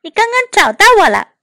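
high-pitched protest; crying out. A narrator's reading. Oliver to Mother who fell on him, high cry. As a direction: cry out as if just landed on. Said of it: "奥利弗喊了起来".

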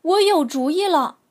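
bright, loud eager idea; excited. A narrator's reading. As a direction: burst out with a happy idea. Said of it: "西德想".

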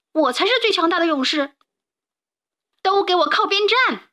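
high, pushy bear voice; boast, then order rivals aside, pitched high; boastful bear. A narrator's reading. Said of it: "熊说".

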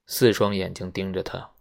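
ordinary delivery

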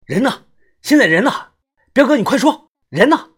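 高声逼问; 声音拔高连声追问; 急怒逼问. A narrator's reading. 逼问彪哥. (愤怒地连声逼问)

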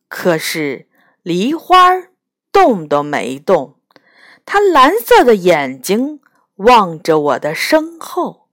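ordinary delivery

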